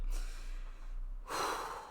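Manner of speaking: long weary exhale; heavy sigh. Real, not acted. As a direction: sigh out the frustration.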